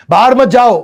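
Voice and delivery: loud high scolding command; sharp shouted no. Shown as a demonstration. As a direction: bark it like an annoyed parent. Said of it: "उसकी जगह बोलो".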